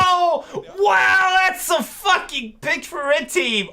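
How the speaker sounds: high, loud, drawn-out astonishment; amazed shouting. Real, not acted. Spectator reacting to red team's pick, astonished shout. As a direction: shout it in real disbelief. Stunned reaction to game play.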